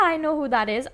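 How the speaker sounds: ordinary delivery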